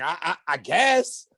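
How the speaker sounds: slow, stammered half-admission; acted hesitant admission. Man admits to friend he's the target, slow hesitant stammer. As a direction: stall on the first word, then give in. Sheepish answer to friend, retold.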